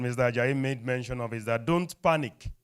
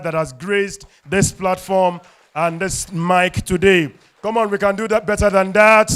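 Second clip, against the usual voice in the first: loud, high MC cheer; applause call; 5 semitones higher, 10 dB louder than usual. Real, not acted. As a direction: pump up the applause. MC calls for applause.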